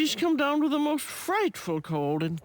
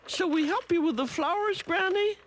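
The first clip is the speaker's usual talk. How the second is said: put-on child's voice; 8 semitones higher than usual. A narrator's reading. Children's voice in narrated story.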